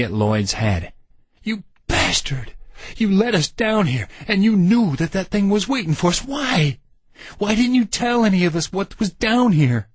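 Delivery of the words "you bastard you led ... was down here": much higher, furious demand; furious, demanding shout; angry shouting. A narrator's reading. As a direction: rage at the betrayal, shotgun aimed. Randy shouts at Lloyd, gun raised.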